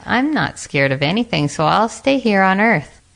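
ordinary delivery

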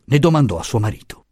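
ordinary delivery

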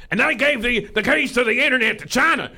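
put-on high, loud Southern-accented rant; Alex Jones. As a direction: rant like a lawnmower struggling to start. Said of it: "That's the best accent that I could do".

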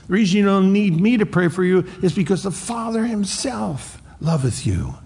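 lifted high, ringing pitch on the verse; soaring, impassioned pulpit delivery; fervent preaching peak. Real, not acted. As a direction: preach it out with full fervor. Preacher peaks on key verse.

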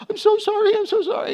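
high mock wail after gasping breaths; imitated hyperventilating. Crying son apologizing to his dad, hyperventilating. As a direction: play a sobbing child unable to catch breath. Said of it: "He's hyperventilating".

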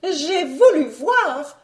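high, loud, drawn-out retort; riposte. A narrator's reading. Baroness answers her husband back, high slow retort. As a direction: fire back haughtily, stretching the words high. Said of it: "ripostait la baronne".

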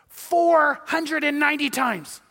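slow, high and emphatic; slow high emphasis; drawn out for emphasis. Shown as a demonstration. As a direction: stretch out every word of the number. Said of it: "drawn-out figure for emphasis".

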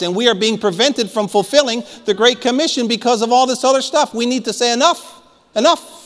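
high-pitched, urgent exhortation; fired-up preaching. Real, not acted. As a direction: rouse the congregation to rise up. Preaches at full force.

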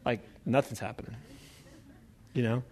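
very soft, low taunting aside; low-key trash talk. Acted. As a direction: lean in and needle them quietly. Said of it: "this is kind of biblical trash talk".

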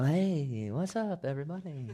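mock-nonchalant greeting, high and lilting; Jesus. Mocking a laid-back Jesus greeting everybody, nonchalant. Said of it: "It wasn't nonchalant. He's not apathetic".

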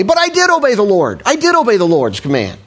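high, insistent whiny denial; defensive protest. Retold or imitated. Saul protesting to the prophet that he obeyed. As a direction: protest your innocence. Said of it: "Verse twenty".

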